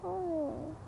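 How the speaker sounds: ordinary talk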